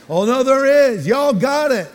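high, loud Southern rebuttal; Southern accent. Acted. Friends in the South contradicting him, imitated Southern accent. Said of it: "All my friends in the South say".

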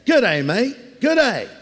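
put-on light Australian accent, cheerful; a hint of Australian accent; Australian greeting. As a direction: greet like a friendly Aussie. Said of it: "Over in Australia".

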